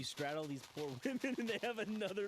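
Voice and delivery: gleeful, laughing incredulity; laughing disbelief. Real, not acted. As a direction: voice climbing high through laughter. Laughs while guessing what happened.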